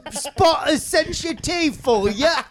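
high, drawn-out mock English accent; slow it down into a hammed-up Cockney; exaggerated English accent. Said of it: "she had an English accent".

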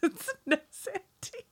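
words squeezed out through laughter; laughing. Real, not acted. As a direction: laugh your way through the line. Joke repeated while laughing.

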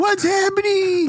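high, drawn-out shriek; hammed-up crowd hysteria; mock panicked scream. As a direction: cry out in confused panic. Second player joins the panic.

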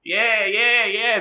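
put-on a street kid hailing the line bull; cheeky street kid. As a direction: shout up at him like a grubby street kid.

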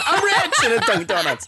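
high, laughing mock movie line; giggly, high-pitched delivery; laughing parody. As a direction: crack up while parodying the line. Joking parody of the film line.

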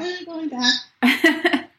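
high, slow imitation of a small child; imitated toddler asking, high-pitched and slow; little boy's voice. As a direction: draw the question out like a little kid. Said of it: "to hear that little voice".

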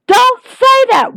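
a fan yelling at Jericho to take it back, high shout; mock alarmed shout. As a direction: cry out to stop him, high and loud. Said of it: "You're like".

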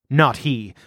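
usual speaking voice

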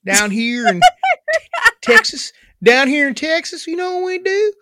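slow, drawn-out Texas drawl; hammed-up hillbilly Texan, whooping at the end; hillbilly Southern accent. Shown as a demonstration. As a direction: play an exaggerated Southern yokel. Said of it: "I can only do hillbilly".

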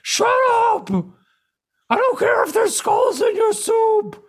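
high furious yelling; angry shout. Retold or imitated. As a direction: scream it at him in anger. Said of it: "And Piccolo's like".

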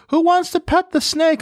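sing-song high call to the crowd; high mock lady call. Mocking the lady offering the boa to pet, high and sing-song.

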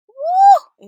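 high loud woo of disbelief; a big shocked woo; loud high exclaiming woo. Real, not acted.

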